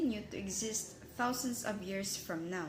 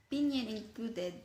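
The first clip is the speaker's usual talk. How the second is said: ordinary talk, nothing marked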